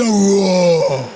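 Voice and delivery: a short crying wow from next door; mock wail. Overheard in the office, a mock wail. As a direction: let out a mock sob of wow.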